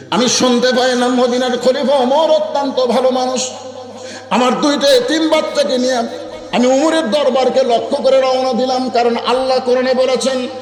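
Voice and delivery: high, ringing sermon chant; impassioned preaching. Acted. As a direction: preach it high, stretching the phrase endings. Preacher at full pitch.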